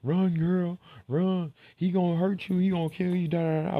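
mock alarmed shrieking; berserk rage. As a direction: shriek a panicked warning, loud and high. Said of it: "and everybody".

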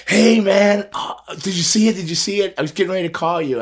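high, eager voice of a buddy; excited friend. Retold or imitated. Edge calling him excited about the tribute, high eager voice. Quoting Edge's excited call.

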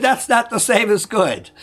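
high-pitched, insistent comeback; imitated roommate's objection.

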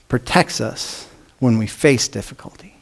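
a hushed, earnest whisper to make the point land; emphatic whisper. Real, not acted. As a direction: drop to a hushed whisper for the key phrase. Contrasting guards with protects.

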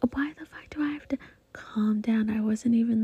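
ordinary talk

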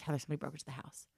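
retold hushed alarm from the sister; hushed whisper.

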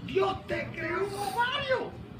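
very high-pitched acted astonishment, stretched out; amazed reaction. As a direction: squeal it in mock disbelief. Acts out his amazed reaction. (asombro actuado con voz muy aguda)

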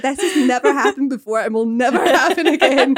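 high, loud, breaking into laughter; laughing protest. Retold or imitated. Podcaster retelling her reply to the visitor, laughing protest. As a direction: protest it loudly while cracking up.

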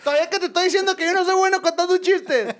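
high-pitched outraged comic rant; mock indignation. Comedian berating the commander over the joke, indignant.

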